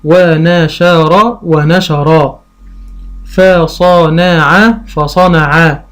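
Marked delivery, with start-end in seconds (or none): 0.0-1.4 ونشر stretched out very slowly, rising at the end; very slow sounding out. Shown as a demonstration. Teacher sounding out for a child, slowly. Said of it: "فيقول قطع". 3.2-4.8 فصنع stretched out very slowly, rising at the end; very slow sounding out. Shown as a demonstration. Teacher sounding out for a child, slowly. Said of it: "فيقول قطع".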